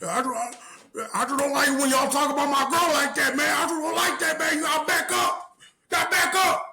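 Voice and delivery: high, loud mock shout; yelled, squeaky imitation of an overheated cousin; cousin shouting back. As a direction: cousin yelling at family to back off from his girl. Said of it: "he's like".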